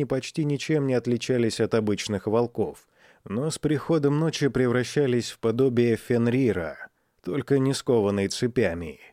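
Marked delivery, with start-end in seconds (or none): none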